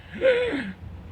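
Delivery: high-pitched mock gasp; imitated gasp. Liquor store clerk hearing the whole story, high mock gasp. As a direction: gasp like a shocked listener. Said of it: "She's like".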